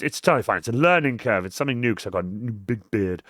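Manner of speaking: usual speaking voice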